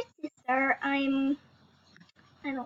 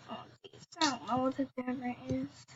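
whispered mutter; 5 semitones lower, 10 dB quieter, faster than usual. Real, not acted. Muttering, unvoiced.

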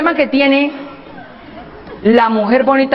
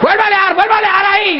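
high, insistent command; bossy woman; 6 semitones higher, faster than usual. Acted. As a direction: order him to crank again. Mujer ordenando arrancar. (mujer mandona, aguda)

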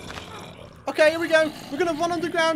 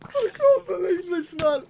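higher, laughing through tears; laugh-crying; 5 semitones higher than usual. Real, not acted. Player watching his base ruined, laughing through tears. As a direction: choke it out between laughs. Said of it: "I'm actually crying".

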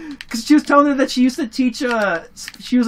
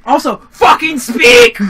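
loud; 5 semitones higher, 9 dB louder, slower than usual. Real, not acted. Demanding friend talk.

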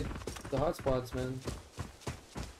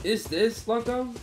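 higher, slowed-down question; 7 semitones higher, slower than usual. Real, not acted. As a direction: stretch the question out, puzzled. Asking again, drawn out.

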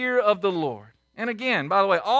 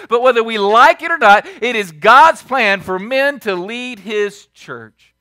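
loud, rising pulpit preaching that slows hard at the close; fiery sermon delivery, slowing for the punchline; full-force preaching; 3 semitones higher, 7 dB louder than usual. Acted. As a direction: preach it to the whole room. Preacher builds to a point.

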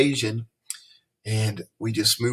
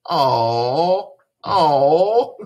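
cooing "Aw, aw" rising higher and louder; swooning aw; 4 semitones higher, 5 dB louder than usual. Retold or imitated. Teacher imitates Bella Lowe cooing over a cute boy, swooning. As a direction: melt like a girl seeing a cute boy. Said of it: "when Bella Lowe sees a cute boy, she says".

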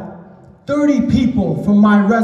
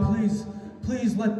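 much softer, slow, begging prayer; desperate quiet plea; 9 dB quieter, slower than usual. Retold or imitated. Panicking student praying to God, soft slow begging. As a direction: plead under your breath for rescue.

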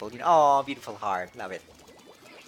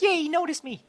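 squeaky chat voice; 5 dB louder than usual. Retold or imitated. Reading chat message aloud.

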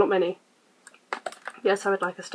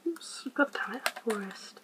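whispered oops and curse under the breath; 13 dB quieter, slower than usual. Real, not acted. Annoyed at misclicking in the game, whispered curse. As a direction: hiss the curse to yourself. Muttering at a mistake.

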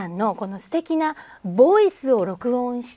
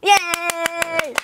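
cheering; 6 semitones higher, much slower than usual. Real, not acted. Cheering after the start call.

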